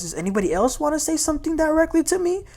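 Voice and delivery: high, fast, heated challenge; mock-heated demand from the dinner. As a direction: fire it back at the whole table. Britt was a little heated.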